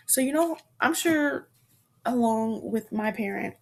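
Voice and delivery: ordinary talk, nothing marked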